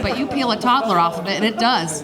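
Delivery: ordinary talk, nothing marked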